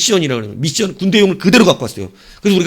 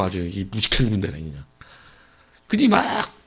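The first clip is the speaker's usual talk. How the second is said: ordinary delivery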